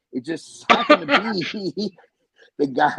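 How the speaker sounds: real laughter breaking up the story; laughing. Real, not acted. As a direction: struggle to tell it while cracking up. Cracks up telling the coincidence.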